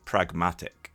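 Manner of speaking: usual speaking voice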